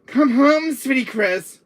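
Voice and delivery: high, loud mock holler; loud hailing call. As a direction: imitate someone yelling for a sweetheart to come home. Relaying a call to come home.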